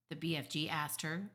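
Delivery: ordinary delivery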